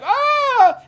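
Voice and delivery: high hammed-up shriek; shrill pretend scream; mock scream. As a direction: let out a fake panicked yell.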